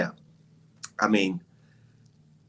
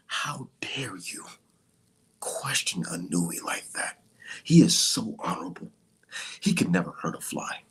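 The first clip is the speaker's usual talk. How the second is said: breathy fanboy awe, sending up his critics; Bart Kriegel voice; 5 semitones lower than usual. Retold or imitated. Mocking Twitter fans in a hushed Bart Kriegel voice. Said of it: "That's my Bart Kriegel voice".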